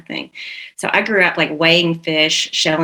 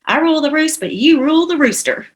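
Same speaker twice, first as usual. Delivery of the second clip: lightly imitating husband; 8 semitones higher than usual. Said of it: "he would say things like".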